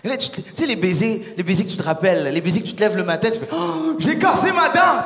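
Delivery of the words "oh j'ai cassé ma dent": high, slow, stretched-out squeal; a hammed-up yelp at a chipped tooth; exaggerated goofy whine; mock pained squeal. Acted kiss-scene quote, very high.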